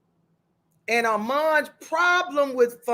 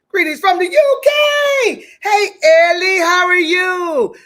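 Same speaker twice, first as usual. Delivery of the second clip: excited greeting; 7 semitones higher, 9 dB louder than usual. Real, not acted. Host greeting a viewer from the UK, excited shout. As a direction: call out a big welcome, pitched way up. Calling out welcome to overseas viewer.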